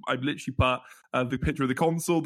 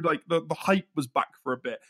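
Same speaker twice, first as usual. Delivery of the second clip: normal talking voice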